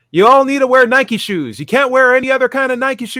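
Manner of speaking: high, loud hammed-up priest voice; mock preachy priest. Comic imitation of a priest lecturing his own congregation. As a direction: lay down the law in a mock sermon tone. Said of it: "and be like".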